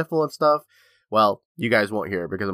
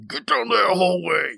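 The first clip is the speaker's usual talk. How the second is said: higher, slow mock-stern command; lightly voiced stern parent; stern parent order; 8 semitones higher, slower than usual. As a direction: order the kid down the hall, slowly. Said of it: "and I was like".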